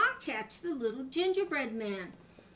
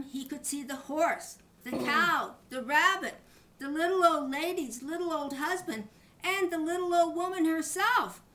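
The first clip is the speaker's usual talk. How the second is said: rising higher and louder down the list of chasers; building excitement; 5 semitones higher, 4 dB louder than usual. A narrator's reading. Narrator piling up everyone chasing the gingerbread man, growing excited.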